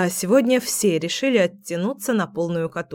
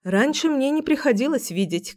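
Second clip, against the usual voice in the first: usual speaking voice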